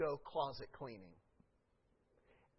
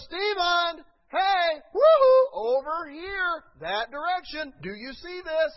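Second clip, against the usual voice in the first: high, loud calling out, drawn-out woohoo; God calling out; 12 semitones higher, 14 dB louder, slower than usual. Retold or imitated. God calling Stephen over, loud high call. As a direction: call him over from far away. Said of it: "Like, He goes".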